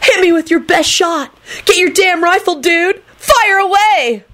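very high, hammed-up wailing. A narrator's reading. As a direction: wail it dramatically, stretching the last words. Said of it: "Kenny wailed".

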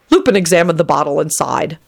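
ordinary delivery, nothing marked

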